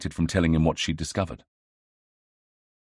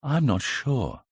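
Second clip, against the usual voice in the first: thoughtful; 8 semitones higher, slower than usual. A narrator's reading. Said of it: "thought about it carefully".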